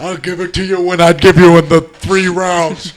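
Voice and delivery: put-on voice. Man playing along after the princess bit, loud put-on voice. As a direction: put on a big, loud character voice.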